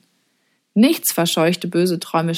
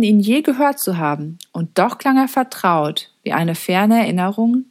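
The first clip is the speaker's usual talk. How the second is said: usual speaking voice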